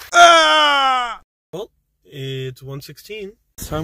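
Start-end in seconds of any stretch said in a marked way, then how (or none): 0.1-1.2 loud, high-pitched yell; sudden scream. Real, not acted. Lone driver cutting loose with a loud, high scream. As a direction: let out one long high scream.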